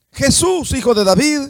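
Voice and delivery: high, loud cry for attention; blind man calling out. Acted. Blind man calls to Jesus over the crowd, calling out.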